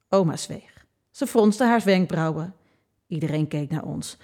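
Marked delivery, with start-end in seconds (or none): none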